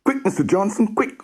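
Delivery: put-on high, breathless boy's call; urgent alarm. Sam urging Rory's dad to come quickly. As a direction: blurt out the emergency.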